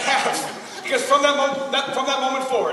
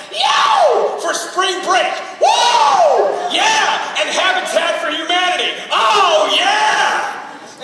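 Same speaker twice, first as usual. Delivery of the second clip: loud, high whooping excitement; over-the-top spring-break hollering; hyped-up cheering; 6 semitones higher, 5 dB louder, much slower than usual. Acted. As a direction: whoop it up like a party crowd. Hammed-up excitement about spring break trip.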